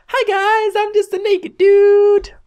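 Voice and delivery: very high, slow silly dude voice; cartoonish high squeaky guy; goofy character voice. Voicing the naked friend.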